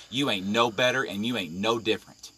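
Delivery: usual speaking voice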